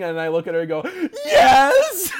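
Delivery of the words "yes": laughing shout. Retold or imitated. Admitting to crying in theater.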